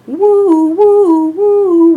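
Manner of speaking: high, loud siren wail; siren whistle. A narrator's reading. Fire truck racing through town, imitating its whistle loudly.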